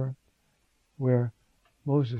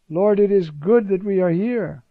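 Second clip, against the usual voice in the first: eager, louder and higher, blurting out his offer; enthusiastic Peter; 4 semitones higher, 6 dB louder than usual. Retold or imitated. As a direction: Peter carried away by joy. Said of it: "he's enthusiastic".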